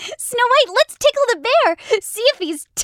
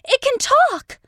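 frightened gasp; 6 semitones higher than usual. Acted. Girl frightened at bear.

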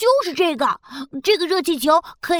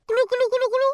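bubbly gurgle; 8 semitones higher, much faster than usual. Acted. Cola bubbles in reply.

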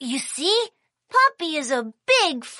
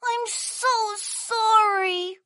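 slow, softer apology; sorry apology; 5 dB quieter, slower than usual. Acted. Kiki apologising to Puppy, slow and soft. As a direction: stretch it out softly and apologetically.

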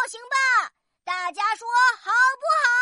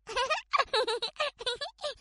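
light, high, soft giggle; story giggle; 5 semitones higher, 8 dB quieter than usual. Acted. Storyteller acting out a character's reaction, soft giggle. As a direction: titter softly in a high character voice.

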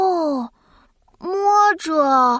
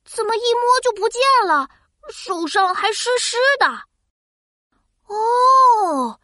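surprised; 6 semitones higher than usual. Acted. Drops vanish when touched.

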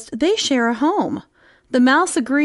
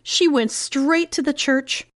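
ordinary delivery

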